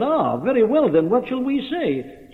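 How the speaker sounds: high-pitched imitation of an objector's challenge; objectors' retort. Preacher quotes the apostle's critics answering him, high challenging retort. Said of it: "This is what they said about him".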